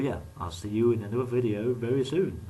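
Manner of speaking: normal talking voice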